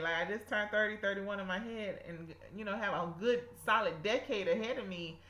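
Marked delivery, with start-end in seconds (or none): none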